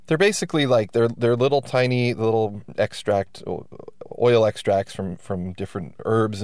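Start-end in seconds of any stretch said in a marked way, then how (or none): none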